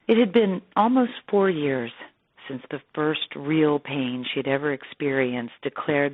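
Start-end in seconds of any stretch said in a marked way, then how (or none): none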